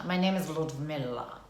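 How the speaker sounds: low, slow mock Russian accent; lightly put-on Russian accent, slowly. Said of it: "Give us your Russian accent".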